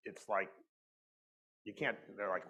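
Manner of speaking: ordinary talk, nothing marked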